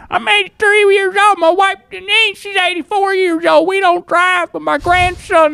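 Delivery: put-on very high, frail old man voice; grandpa voice. Grandpa voice scamming a restaurant for food. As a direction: sound like an eighty-three-year-old. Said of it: "in a grandpa voice".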